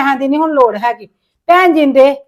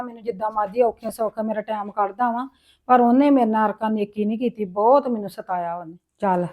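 quiet aside; 5 semitones lower, 7 dB quieter than usual. Acted. Widow's quiet aside after he leaves.

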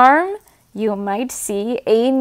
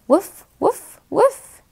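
high mock dog woofs rising higher; playful barking; 6 semitones higher than usual. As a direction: yap like a little puppy. Dog sounds in farm book.

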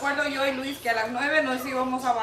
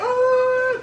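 high, loud frightened "uh"; a shudder of fear; fearful groan; 10 semitones higher, 7 dB louder than usual. Retold or imitated. Wife recalling the scary drive down, frightened and loud. Retelling fear while driving.

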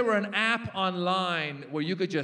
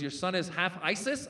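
normal talking voice

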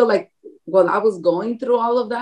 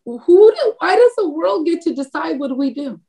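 pitched way up, indignant; exasperated high-pitched rhetorical question; indignant retort; 7 semitones higher than usual. Retold or imitated. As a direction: fire it back at the world, high and outraged. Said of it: "I'm like".

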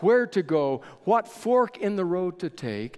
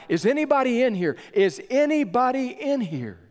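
high, fast searching call into the smoke; 8 semitones higher, 3 dB louder than usual. Retold or imitated. Officer calling into the dark burning building for survivors, high urgent call. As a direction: cry out to find anyone inside. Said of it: "he began to cry out".

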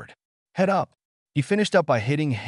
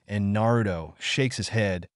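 ordinary delivery, nothing marked

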